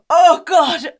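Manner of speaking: loud, high horrified gasp; loud horrified "Oh, God"; shocked gasp. Retold or imitated. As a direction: gasp in horror. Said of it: "I just went".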